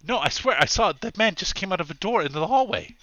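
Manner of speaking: high, loud, agitated protest; worked-up, high-voiced witness; insistent tourist witness.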